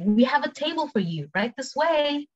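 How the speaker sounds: high-pitched, flowing service line; quick take. Shown as a demonstration. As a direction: say it as one conversational run. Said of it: "cepet sama pelan".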